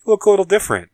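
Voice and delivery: high, loud mock-quote of a friend; joking best friend. Best friend ribbing the recast actor, high and joking. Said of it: "they make the joke where his best friend comes up and he goes".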